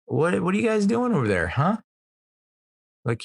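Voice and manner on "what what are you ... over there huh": high, playful mock-cheery tease; lightly voiced parent ribbing the kid; parent teasing kid. Family member calling to the kid, high and playful. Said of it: "it's like".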